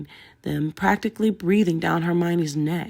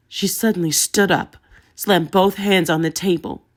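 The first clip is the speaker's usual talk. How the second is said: ordinary delivery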